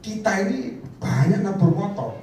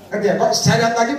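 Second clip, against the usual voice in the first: ordinary talk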